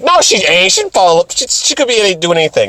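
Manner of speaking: high-pitched mock protest; imitating a flustered objection; indignant denial. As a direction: protest jealously, stumbling over the words. Artemis indignantly denies the rumour.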